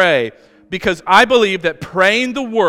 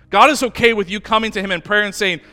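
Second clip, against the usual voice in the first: normal talking voice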